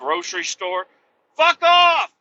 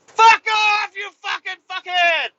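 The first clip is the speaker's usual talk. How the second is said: high, loud furious yell; hammed-up screaming rant; mock rage; 12 semitones higher, 8 dB louder than usual. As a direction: scream it at the Jeep guys in a hammed-up fury. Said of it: "J K".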